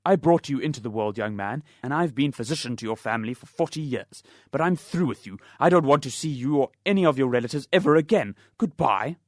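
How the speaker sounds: loud, indignant dismissal; angry dismissal. Acted. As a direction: throw him off for good. Angry doctor dismisses the father.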